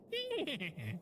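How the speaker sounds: high, soft falling giggle. Real, not acted. Streamer amused by chat, soft high giggle. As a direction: let out a small, high-pitched titter.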